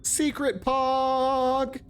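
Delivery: high, slow drawn-out cheer; excited shout. Real, not acted. Streamer celebrating a found secret, high drawn-out cheer. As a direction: stretch it out like a victory call. Streamer finds hidden collectible.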